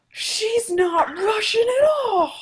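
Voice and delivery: gasp, then loud high drawn-out disbelief; shocked outburst. Real, not acted. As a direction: cry out in stunned disbelief. Shocked reaction to the game's reveal.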